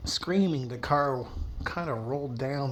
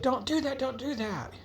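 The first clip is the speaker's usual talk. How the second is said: much higher, frantic begging; panicked pleading; 14 semitones higher than usual. Acted. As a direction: plead for your life, voice up high. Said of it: "She's like".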